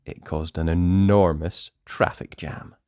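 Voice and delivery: plain reading voice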